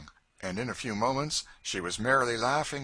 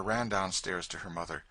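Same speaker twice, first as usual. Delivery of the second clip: ordinary delivery, nothing marked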